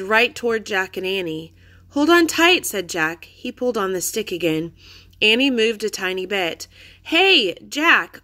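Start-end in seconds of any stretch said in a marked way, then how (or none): 1.9-2.7 high, loud, urgent; urgent cry. A narrator's reading. Jack calls to Annie as wildebeest come, high and loud. Wildebeest approaching, urging sister. 7.0-8.2 calling out. A narrator's reading. Annie calls her brother.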